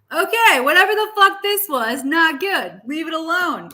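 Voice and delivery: high, loud fairy dismissing it. Acted. Reader voicing the fairy, high and loud. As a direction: play the fairy waving it off. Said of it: "because they're like".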